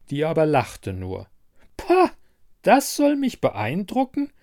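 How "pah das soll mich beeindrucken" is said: high, scoffing disbelief; haughty, high-pitched disdain; contemptuous. A narrator's reading. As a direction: scoff loudly, then question it with scorn. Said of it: "fragte sie verächtlich".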